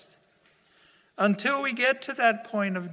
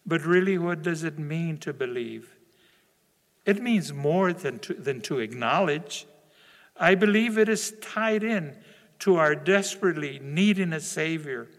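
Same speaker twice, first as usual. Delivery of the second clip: ordinary talk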